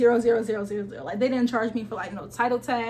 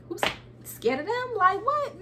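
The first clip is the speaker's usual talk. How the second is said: mock disbelief; 9 semitones higher than usual. Mocking fear of scary lawyers.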